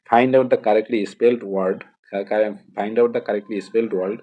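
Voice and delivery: very slow, word by word English reading; slow dictation read. Shown as a demonstration. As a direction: read it out slowly for the students. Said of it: "reading the question slowly". (ধীরে ধীরে পড়ে শোনানো)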